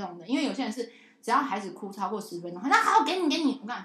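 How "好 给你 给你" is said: high, loud exasperated give-in; mock exasperated parent caving. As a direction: throw up your hands and give in.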